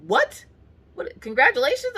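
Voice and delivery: high, squeaky baffled what; confused high-pitched congratulations; baffled squeak. Retold or imitated. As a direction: pitch leaps up in total confusion. Confused reply to dad's brag.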